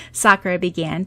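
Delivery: ordinary delivery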